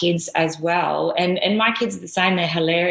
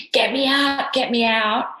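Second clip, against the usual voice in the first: mock panic; 6 semitones higher than usual. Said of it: "I was pressing the button".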